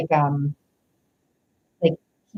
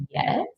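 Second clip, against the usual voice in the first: high-pitched YES as a question; 13 semitones higher, 3 dB quieter than usual. Retold or imitated. Reader voicing his unsure answer, questioning yes. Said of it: "with a question mark".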